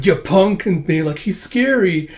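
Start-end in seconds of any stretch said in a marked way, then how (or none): 0.0-0.6 higher, louder mock-tough taunt; tough punk. Tough greaser looking punk accosting someone, mock-tough taunt. 1.2-2.1 high-pitched little fright; mock scared. Woman seeing a tough greaser, mock-scared high voice.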